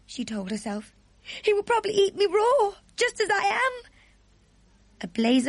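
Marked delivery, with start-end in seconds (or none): 1.4-3.9 put-on high, small scared girl voice; a young girl whispering her fears; frightened little girl. Sophie fears being eaten.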